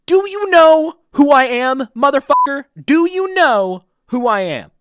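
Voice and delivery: high, slow, very loud mock yell; over-the-top shouted challenge; hammed-up outburst. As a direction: bellow a drawn-out, indignant challenge in mock rage. Acted outburst at mechanic, second take.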